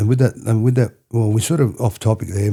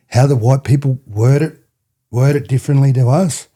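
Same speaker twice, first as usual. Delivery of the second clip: mum's words; 5 semitones higher, 4 dB louder than usual. Retold or imitated. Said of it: "she said it like this".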